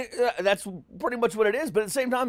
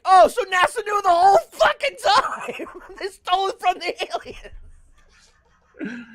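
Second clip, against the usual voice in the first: mock revelation; 11 semitones higher, 5 dB louder, slower than usual. Mock realization about aliens.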